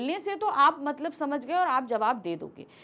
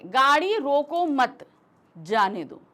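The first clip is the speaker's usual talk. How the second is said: group रोको मत together and stop before जाने दो; loud, deliberate demo with the pause after मत; 5 dB louder, slower than usual. Shown as a demonstration. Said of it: "इसके बाद रुके".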